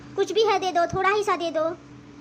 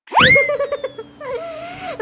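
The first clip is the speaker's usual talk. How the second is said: loud wail; 9 semitones higher, 5 dB louder than usual. Acted. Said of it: "अरे माँ, आप रो क्यों रही हैं?".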